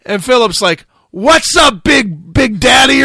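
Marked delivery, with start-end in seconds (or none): 1.1-3.0 loud, high, shouted buddy greeting; mock hype greeting. Philip loudly greeting the host mid-interview, hyped. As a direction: bellow it like a buddy spotting you.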